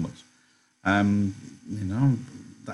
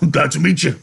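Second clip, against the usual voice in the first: loud, high hearty greeting; Carlson; 6 semitones higher, 6 dB louder than usual. A narrator's reading. Big man greeting the second newcomer, loud and hearty. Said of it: "Carlson said again".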